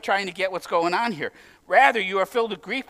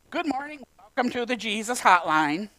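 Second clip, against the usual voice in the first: hotline operator; 3 semitones higher than usual. Acted. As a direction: answer like a cheery switchboard operator. Plays a hotline operator answering.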